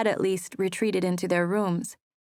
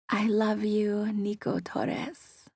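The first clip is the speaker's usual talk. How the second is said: breathy whisper, slow, with a rolled R Spanish lilt on the name; 3 semitones higher, 3 dB quieter, much slower than usual. A narrator's reading. Mia whispers her love into Nico's ear, Spanish accent on his name. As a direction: whisper it right into his ear, playful and sassy. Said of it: "I whispered".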